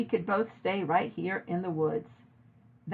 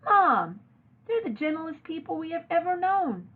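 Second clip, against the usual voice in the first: high, pleading protest; indignant daughter protesting; upset protest; 10 semitones higher than usual. A narrator's reading. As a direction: plead against her mother's words. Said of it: "I cried".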